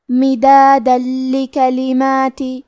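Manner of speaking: مدادا لکلماتی very slow, tanween merged into ل without nasal ghunna; tajweed demo. Shown as a demonstration. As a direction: say مدادا لکلماتی slowly, folding the nun into a doubled ل. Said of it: "تنوین کو لام میں ملا کے بغیر غنہ". (مدادا لکلماتی بہت آہستہ، تنوین کو لام میں بغیر غنہ ملا کر)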